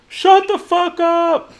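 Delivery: high, drawn-out heckle from off-stage; mock yell. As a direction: yell it slowly, as a joke. Imagined heckle from round the corner.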